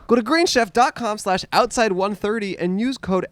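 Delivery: ad read. Acted. Ad read promo code.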